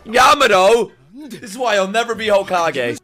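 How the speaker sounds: loud, high exasperated call; scolding shout. Real, not acted. Viewer telling off Yamato, scolding shout. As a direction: holler the name, then scold. Mock scolding the character aloud.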